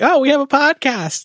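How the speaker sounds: cheery mock answer. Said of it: "It's like".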